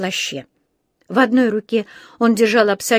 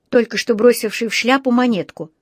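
ordinary delivery, nothing marked

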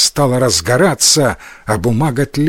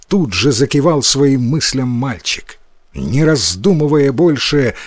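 usual speaking voice

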